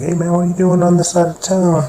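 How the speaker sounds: called out high and loud across the street; loud confrontation. Acted. Carlos calls out to Malcolm, loud and high.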